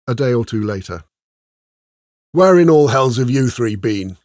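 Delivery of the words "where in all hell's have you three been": loud, demanding sergeant's outburst. A narrator's reading. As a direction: demand an answer from your men at full volume. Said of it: "Sergeant Bastard demanded".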